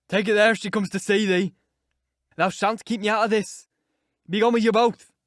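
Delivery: loud and raging; angry shout. A narrator's reading. Earnshaw ordering Catherine and Linton out, angry shout. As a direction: order them out, furious.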